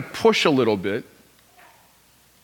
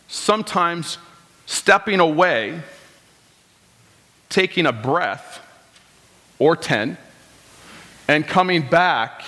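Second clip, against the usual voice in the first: usual speaking voice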